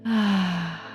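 voiced, falling sigh of release. Shown as a demonstration. Guide releasing tension along with listeners, audible exhaling sigh. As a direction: exhale all tension in one open sigh. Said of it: "with a sigh".

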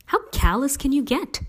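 high-pitched outraged question; exasperated, voice leaping up; indignant. A narrator's reading. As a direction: protest in scandalised disbelief. Stevie outraged at her boyfriend.

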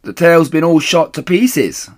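loud, high reply; calling down. Acted. Missus Bean calls down from upstairs, loud and high. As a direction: shout it down the stairs. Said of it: "said the voice from upstairs".

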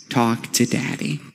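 put-on soft, hushed little girl answer; small daughter's voice. Father imitating his sleepy daughter at bedtime, soft and hushed.

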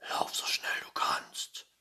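breathless hushed whisper; urgent whisper. A narrator's reading. Pettersson urges Findus to run, urgent whisper. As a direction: whisper the command to flee. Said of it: "flüsterte Patterson".

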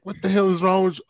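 fast, high-pitched retold protest; mock indignant. Retelling a reaction to being shoved at the escalator, indignant and quick. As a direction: snap it quickly, as a re-enacted outburst. Retells anger at celebrity shoving her.

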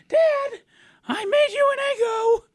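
put-on high, loud voice of a small kid; child voice. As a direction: play a nervous little kid proudly serving breakfast. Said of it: "your child voice sound like a creepy old man".

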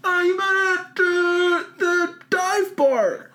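high, drawn-out pretend crowd voice; audience member. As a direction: call out slowly in a high put-on voice. Plays audience calling out suggestion.

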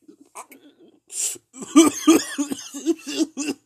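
high, loud sputtering cough-laugh; choking on the dab hit. Retold or imitated. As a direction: sputter out the smoke.

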